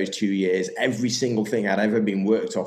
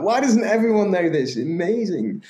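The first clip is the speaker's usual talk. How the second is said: much higher, louder, wide-eyed disbelief; imitating an excited newcomer to health stuff; amazed discovery; 11 semitones higher, 4 dB louder than usual. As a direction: gush like you just found a secret.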